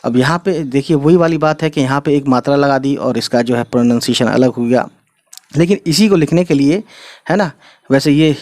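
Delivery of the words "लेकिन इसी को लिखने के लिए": ordinary delivery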